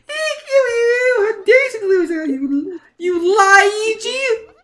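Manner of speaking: high-pitched, outraged superfan; hammed-up fangirl meltdown; disgusted Luigi fan. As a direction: rant in horror, high and squealing. Fan rejects the false Luigi.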